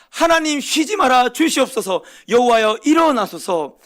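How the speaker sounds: high, loud, slow pleading shout; fervent outcry. Retold or imitated. Prophet crying to God not to rest, loud slow plea. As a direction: call on God with full trust. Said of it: "이렇게 외치고 있다는 사실입니다".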